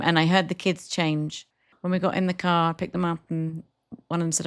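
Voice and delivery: normal talking voice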